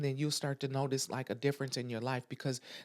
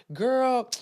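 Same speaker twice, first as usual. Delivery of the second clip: higher, louder mock girl; mock gossipy friend; 7 semitones higher, 6 dB louder than usual. Friend calling with drama, mock gossipy girl.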